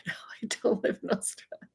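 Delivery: quick whispered denial through a laugh; hushed giggling no. Real, not acted. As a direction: breathe it out fast with a laugh. Laughing reply to the comment.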